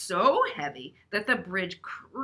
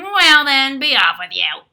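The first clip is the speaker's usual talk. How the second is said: troll roar; 5 semitones higher, 14 dB louder than usual. Acted. Troll voiced in story.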